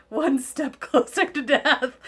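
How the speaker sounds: laughing through the words. Real, not acted. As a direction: giggle it out. Joking about birthday dread.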